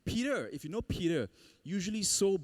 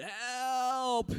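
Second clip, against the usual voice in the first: retold cry for help; 9 semitones higher than usual. Swimmer retelling his cry to the surfers, a mild mock yell. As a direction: call it out like the swimmer who gave up. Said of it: "I actually screamed and shouted".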